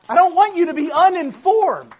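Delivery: emphatic call. Real, not acted. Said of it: "repeated for emphasis".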